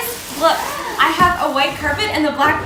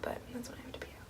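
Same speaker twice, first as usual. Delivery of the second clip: whisper; 27 dB quieter, much faster than usual. Real, not acted. Aside about leaving residence.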